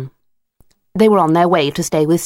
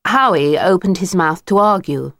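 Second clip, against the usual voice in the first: ordinary delivery, nothing marked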